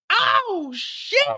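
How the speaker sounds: high falsetto oh shit; squeaky shocked exclamation; startled cry. Retold or imitated. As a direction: yelp it out in shock. Narrator reacts to gunshot.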